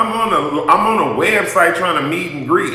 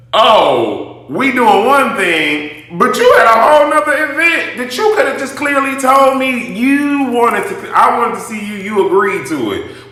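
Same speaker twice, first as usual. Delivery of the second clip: mock excuse; 7 semitones higher, 3 dB louder than usual. Mocking his excuse.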